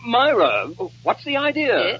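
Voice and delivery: high, flustered question; startled protest. Acted. Nicky as Myra climbs on his lap, startled protest. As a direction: yelp it in alarm.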